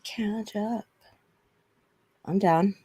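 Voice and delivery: ordinary delivery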